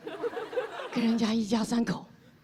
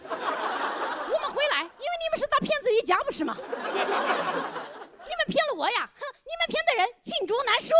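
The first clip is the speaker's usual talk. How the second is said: put-on high, halting foreign-accented Mandarin; foreigner speaking mandarin; 12 semitones higher than usual. Thomas accuses the family.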